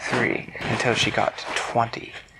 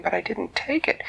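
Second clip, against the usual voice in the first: put-on higher little boy's voice; protesting goblin boy; 10 semitones higher than usual.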